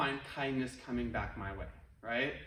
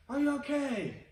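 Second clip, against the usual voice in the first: higher, distant echoing call; mock echo; 7 semitones higher than usual. The valley echoes the worried kid's call, distant. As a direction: imitate a valley echo throwing it back. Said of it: "they heard back".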